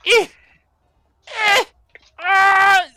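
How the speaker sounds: high, loud squealing yelps; muffled shrieks of a tumbling creature; kobold yelps. Acted. Kobold heard behind door.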